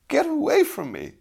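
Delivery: very high, loud, angry outburst; angry cry. A narrator's reading. Lady orders the youth away, crying out angrily. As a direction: drive him off in a high-pitched fury. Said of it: "cried the lady angrily".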